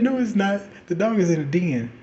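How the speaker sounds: high loud stepmother voice; high, loud hammed-up stepmother; stepmother insisting. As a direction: hit it high and firm like a stern stepmom. Stepmother contradicts her.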